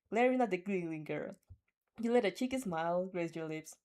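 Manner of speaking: ordinary talk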